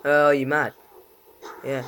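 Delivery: ordinary delivery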